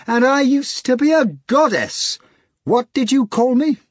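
high, loud demanding tone; scolding. A narrator's reading. Natasha pinches the hog's ear, high scolding. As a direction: demand an answer.

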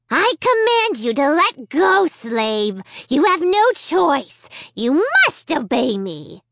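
high, loud, slow evil puppet command; commanding dummy. Acted. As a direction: order it like a tyrant dummy. Slappy orders Amy.

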